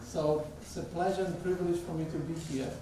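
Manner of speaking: normal talking voice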